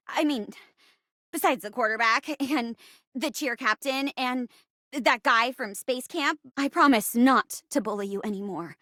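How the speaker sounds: usual speaking voice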